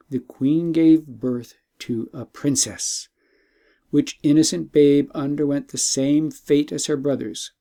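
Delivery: usual speaking voice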